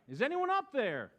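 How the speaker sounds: calling up high-pitched and pleading; stranded man calling upward, frightened; desperate call. A narrator's reading. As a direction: beg for anyone up there. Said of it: "he cried".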